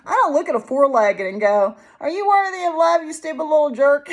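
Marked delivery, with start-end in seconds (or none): 2.0-4.1 high-pitched playful insult; mock scolding. Speaker to a four-legged, mock scolding. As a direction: pretend to berate a little creature.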